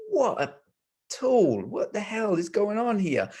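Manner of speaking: high, exasperated mock rant at a stranger; irritated outburst. Commuter fuming at the man who jostled him, exasperated. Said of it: "And I'm like".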